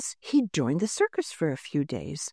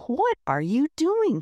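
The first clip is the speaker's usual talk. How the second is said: demanding; 8 semitones higher than usual. Acted. Franny confronting the muffin man over the muffins, demanding. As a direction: insist on an answer now. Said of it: "she demanded".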